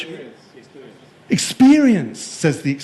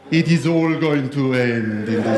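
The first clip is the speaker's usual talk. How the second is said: put-on lower, gloomy Danish-style accent; Northern European accent; 4 semitones lower than usual. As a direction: play a doom-laden Northern European. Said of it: "Northern Europeans".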